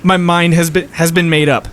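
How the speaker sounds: deliberately stuttered resolve; in-character stutter. Acted. Game master keeps the opponent's slip in character, stuttering. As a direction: trip on the opening words and repeat them. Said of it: "We'll keep that in character".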